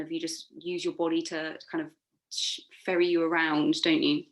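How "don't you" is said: normal talking voice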